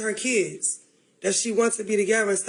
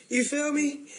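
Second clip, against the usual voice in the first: ordinary talk, nothing marked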